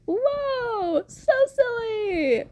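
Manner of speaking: very high, silly squeal; drawn-out, high-pitched goofy wonder; mock amazement. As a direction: coo it in a put-on high voice. Mock reaction to the plot.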